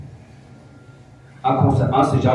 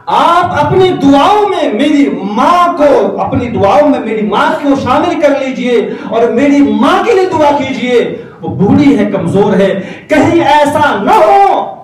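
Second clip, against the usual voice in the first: high, loud, slow earnest plea; pleading; 12 semitones higher, 6 dB louder than usual. Acted. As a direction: beg the teacher for his mother's sake. Boy begs teacher to pray. (استاد سے التجا، بلند آواز میں)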